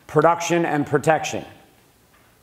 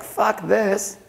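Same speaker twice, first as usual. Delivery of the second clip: higher, fed-up snap; fed-up outburst; 7 semitones higher than usual. Retold or imitated. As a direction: toss it away in disgust. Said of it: "I was like".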